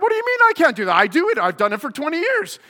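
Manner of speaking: high, loud, indignant disbelief; heated protest. Retold or imitated. Arguing with the teacher about free newspapers, indignant and loud. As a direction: fire back in outrage. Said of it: "heated argument".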